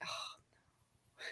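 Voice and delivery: hushed mock dread; a soft whispered oh no. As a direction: breathe it out like a worried reader. Whispered dread at plot turn.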